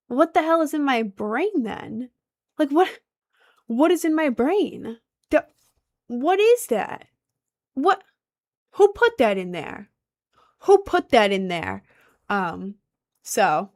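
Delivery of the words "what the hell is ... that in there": high, punchy, incredulous questions; baffled exasperation. Real, not acted. Streamer questioning own faulty brain, baffled and exasperated. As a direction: demand answers in disbelief.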